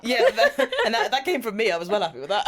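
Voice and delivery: high, loud, through laughter; laughing proudly. Real, not acted. Host admits the pun was theirs, laughing loudly. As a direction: own up to the joke while laughing.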